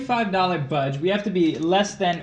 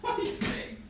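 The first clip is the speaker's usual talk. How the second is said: squeaky, much higher laughing question, softer; high laughing disbelief; 7 dB quieter than usual. Real, not acted. Laughing at bad accent.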